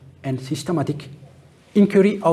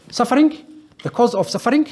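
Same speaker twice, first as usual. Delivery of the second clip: "suffering" lifted high and a bit louder; emphatic stress; 8 semitones higher, 4 dB louder than usual. Real, not acted. Lecturer to students, stressing the Buddhist idea, emphatic. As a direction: hit "suffering" hard and high as the key word.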